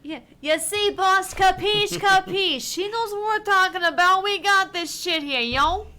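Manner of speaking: over-the-top mob lackey; Italian goon voice. Acted. Henchman agrees with the boss in a hammed-up Italian accent. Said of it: "like, an Italian voice".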